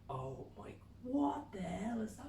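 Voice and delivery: high, slow astonished voice; astonished wife. Retold or imitated. Wife seeing the nebula, high astonished. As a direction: mimic her astonishment.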